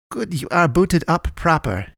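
slow, high character voice; odd little medic's voice. A narrator's reading. Miguel checks on his newly rebuilt patient, stilted.

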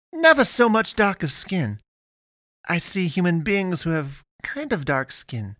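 put-on high, halting girlish figure voice; amused alien pastoress. A narrator's reading. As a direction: explain in a high voice, overcome by amazement.

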